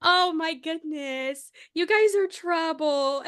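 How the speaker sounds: slow, high-pitched mock fluster; mock flustered scolding. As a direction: tease them as if charmed. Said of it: "she's like".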